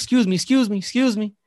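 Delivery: high, loud, repeated call after someone; insistent calling out. Retold or imitated. Stranger calling after a coworker in the hallway, insistent. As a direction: imitate her calling urgently for attention.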